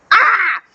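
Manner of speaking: short high-pitched "ah"; overwhelmed fan cry. Real, not acted. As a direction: let out a high cry of delight.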